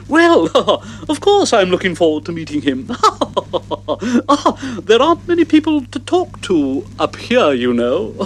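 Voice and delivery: high, giggling lady giraffe; giggly giraffe voice. Acted. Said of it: "Georgina, our giraffe, said".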